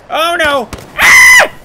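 high, loud cry of fright; panicked scream. Real, not acted. Player panics as enemies chase him, screaming high and loud. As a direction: scream as they close in.

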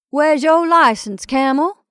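mock demand. Jumbo teasing the groom.

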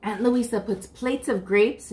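ordinary delivery

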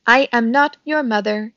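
measured, lightly voiced robot. A narrator's reading. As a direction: state it firmly as a robot. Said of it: "said the robot".

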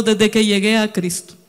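ordinary delivery

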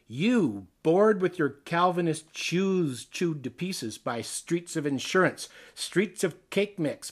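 usual speaking voice

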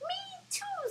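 very high tiny mouse squeak; squeaky mouse. Acted. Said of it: "squeaked the mouse".

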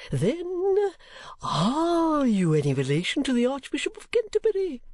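high, swooping anxious questioning; eccentric, overwrought inquiry; great anxiety. A narrator's reading. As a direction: ask urgently in a high old gentleman's voice. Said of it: "inquired the old gentleman with great anxiety".

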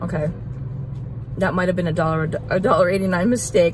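words muffled by chewing; talking while chewing. Real, not acted. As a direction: mouth partly full of chips. Seen 1: Lips pressed, cheeks working between words; chip held earlier.

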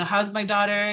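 usual speaking voice

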